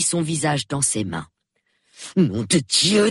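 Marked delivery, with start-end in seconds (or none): 2.2-3.1 repeated oath. A narrator's reading. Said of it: "répéta-t-il".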